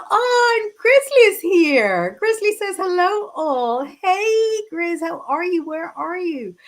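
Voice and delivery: high-pitched, delighted hello; light up as a friend turns up; excited greeting. Real, not acted. Streamer greeting a friend who just joined, excited.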